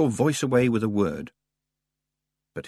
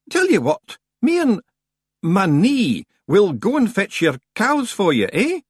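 high, loud Scots offer; bargaining Scots stranger; 12 semitones higher, 5 dB louder than usual. Acted. Stranger offering to fetch cows, high loud Scots.

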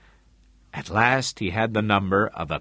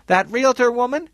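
raised, high-pitched question; calling from kitchen; 6 dB louder than usual. A narrator's reading. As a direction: call it through from the next room. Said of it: "Julie said from the kitchen".

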